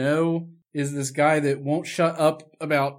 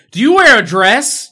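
much higher, louder creepy "Do you wear a dress?"; mock creepy come-on; 8 semitones higher, 13 dB louder than usual. Mocking the creepy guy hitting on her, hammed-up. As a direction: ham up the creep's sudden question. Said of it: "he just goes".

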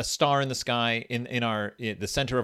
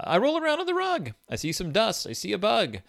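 high, sing-song storybook read; reading to a toddler; 10 semitones higher than usual. Acted. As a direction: read it like to a baby. Reads baby book aloud.

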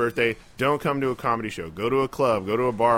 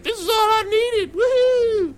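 put-on shrieking drunk cheer, much higher; a tipsy woman whooping with joy; 7 dB louder, slower than usual. Drunk birthday woman cheering over her drink, high. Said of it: "She'd be like".

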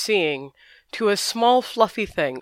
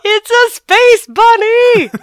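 high, loud, delighted and slow; excited exclamation; 14 dB louder, slower than usual. Real, not acted. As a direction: proudly announce it with glee. Excited reveal of the pet.

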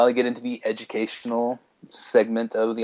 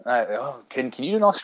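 normal talking voice

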